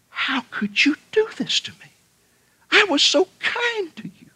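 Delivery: stricken whisper. Acted. Bitten man's dying reproach.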